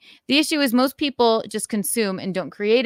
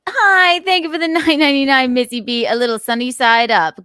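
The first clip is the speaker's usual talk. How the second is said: loud and high, gushing over the gift; excited thanks; 5 semitones higher, 10 dB louder than usual. Real, not acted. Streamer thanking a viewer for a donation, loud and excited. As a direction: burst out with grateful glee.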